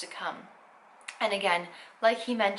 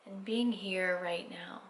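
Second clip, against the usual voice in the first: guided meditation; 6 dB quieter than usual. Acted. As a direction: ease them into stillness, unhurried.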